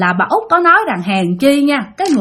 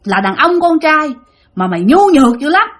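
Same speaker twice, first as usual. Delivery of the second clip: higher-pitched retold scolding; mắng con trai; 4 semitones higher than usual. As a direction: tell him off in a raised voice, acting the aggrieved spirit. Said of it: "quay qua chửi ông Út". (giọng chửi trách cao, kể lại)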